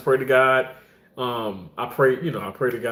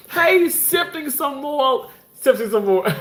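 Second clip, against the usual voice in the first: excited greeting; 12 semitones higher than usual. Real, not acted. Host hailing a viewer who just came in, excited. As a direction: greet them in a high, delighted voice.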